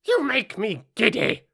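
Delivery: high-pitched cross complaint; screeching Queen. A narrator's reading. Queen to the bowing gardeners, high screech. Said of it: "screamed the Queen".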